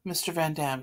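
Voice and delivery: ordinary delivery, nothing marked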